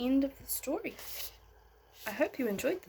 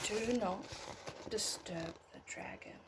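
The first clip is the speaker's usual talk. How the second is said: hushed title; 8 dB quieter than usual. A narrator's reading. Whispered story title.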